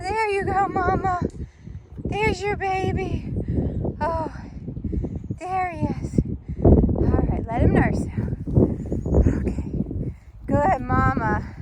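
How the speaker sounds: gently reward the mama cow; praising the cow. Real, not acted. Farmer praising the cow finding her calf, high and gentle.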